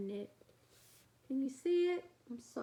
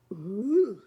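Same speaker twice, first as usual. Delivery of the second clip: slow, louder delighted monster shout; greedy monster; 9 dB louder, much slower than usual. Acted. Big monster spotting Little Shock as a treat, delighted. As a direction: drool over the treat. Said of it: "he said".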